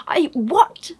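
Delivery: high-pitched incredulous question; astonished. Retold or imitated. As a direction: imitate a friend's disbelief. Acts out friend's stunned message reply.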